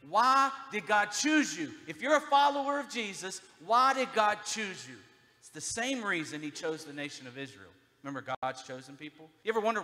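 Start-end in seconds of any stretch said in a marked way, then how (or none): 0.1-5.0 loud preaching. Real, not acted. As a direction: press the question hard on the room. Preacher's rising call to congregation.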